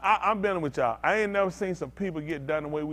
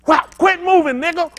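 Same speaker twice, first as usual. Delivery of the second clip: loud, high barked order while whipping; mock slaver yell; 10 semitones higher, 12 dB louder than usual. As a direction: bellow it like a cruel overseer. Acted slaver whipping, shouting.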